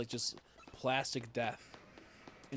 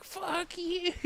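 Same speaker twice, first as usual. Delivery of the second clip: squeaked high fuck you; high mock yelp. Teasing Crystal over a game opinion, high mock yelp. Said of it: "I'm like".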